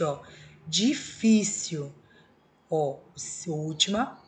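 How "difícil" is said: Difícil drawn out very slowly, syllable by syllable; slow syllable split. Shown as a demonstration. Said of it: "contando sempre de trás pra frente".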